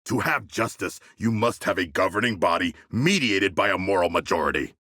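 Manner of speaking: normal talking voice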